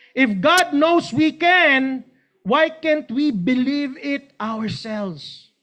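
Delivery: slow, deliberate challenge; slow preaching. Real, not acted. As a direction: lean on every word. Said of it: "preaching point".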